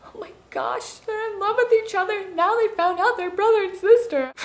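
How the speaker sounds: high, excited gasp turning to shock; mock gushing fan. Reader gasping at the twist, high and excited. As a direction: squeal like a swooning reader. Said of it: "I wasn't like".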